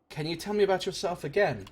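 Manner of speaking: ordinary talk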